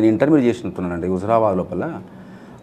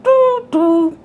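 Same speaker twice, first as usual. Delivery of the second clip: high, loud two-note toot; train horn imitation; 6 dB louder, much slower than usual. Shown as a demonstration. Mimic adds train sound to station announcement, loud high toot.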